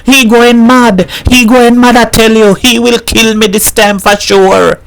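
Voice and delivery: high, loud panicked cry of a woman fleeing; screaming in fear. A narrator's reading. Missus Herrera fleeing the knife, screaming in terror. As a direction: shriek it out, terrified. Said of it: "ran out of the house screaming".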